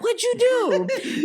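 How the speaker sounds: 1 mock mom demanding. Mom voiced, ready to fight.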